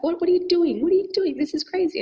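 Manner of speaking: high, loud incredulous panic; alarmed partner. Retold or imitated. Partner hearing about sleeping at a stranger's, high alarmed protest. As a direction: play the freaked-out partner. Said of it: "he was like".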